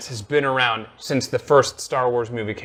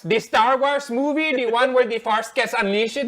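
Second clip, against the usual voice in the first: light imitation of an aunt's Filipino accent, pitched up; 8 semitones higher than usual. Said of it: "my aunt's Filipino accent for you".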